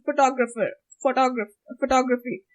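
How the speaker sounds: TOG stressed in "Photographer, photograph-, photography"; English-style stress. Shown as a demonstration. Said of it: "the first ones which I'm saying".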